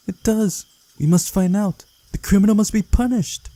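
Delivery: high, loud, righteous insistence; indignant girl. A narrator's reading. Girl insists the criminal be punished, high and indignant.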